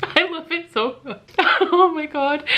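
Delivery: high laugh breaking into the words; overjoyed, laughing and choked up; teary laugh. Real, not acted. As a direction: giggle through happy tears. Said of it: "And I'm just".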